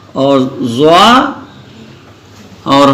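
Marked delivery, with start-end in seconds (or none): 0.6-1.3 زا slow, as a thick "zwaa"; heavy letter. Shown as a demonstration. Teacher listing heavy letters, slow thick "zwaa". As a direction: hold زا long and rounded. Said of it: "جو موٹے پڑھے جاتے ہیں".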